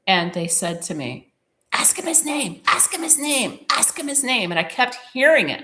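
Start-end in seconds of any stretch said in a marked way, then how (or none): 1.7-4.5 high, urgent chanting spirits; imitating clamouring spirits; insistent spirit voices. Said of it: "all the spirits are just as loud as they can be".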